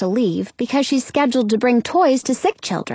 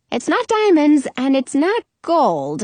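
ordinary delivery, nothing marked

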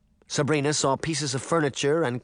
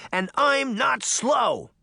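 higher, slower indignant shout; 13 semitones higher, 3 dB louder, slower than usual. A narrator's reading. Girl shouting back at the mayor, indignant. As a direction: protest hard, every word. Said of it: "Sabrina shouted".

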